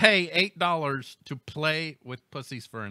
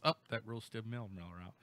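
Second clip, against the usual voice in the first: usual speaking voice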